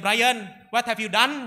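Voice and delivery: loud, slow, drawn-out reproach; scolding teacher. Acted. Drama teacher confronts Brian, loudly aghast. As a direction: demand an answer, high and loud.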